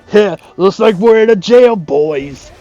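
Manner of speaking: dramatic video-game ninja voice; Espio impression. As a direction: act out a stern ninja cutscene. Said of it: "my impression of S P O".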